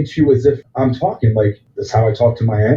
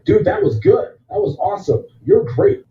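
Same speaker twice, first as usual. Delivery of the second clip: fake praise; 8 semitones higher than usual. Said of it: "if I tell you".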